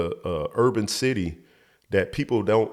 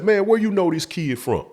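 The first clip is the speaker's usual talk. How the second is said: high-voiced retold interrogation; retold challenge; 10 semitones higher than usual. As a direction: imitate a suspicious challenge, much higher. Retold big man challenging the kidnapper.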